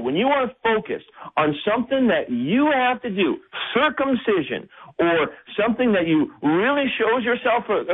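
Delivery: Paul shaking a wayward church awake, pitched high and urgent; Paul's urgent plea. Retold or imitated. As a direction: plead with them to wake up, voice climbing high. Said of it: "And Paul is saying".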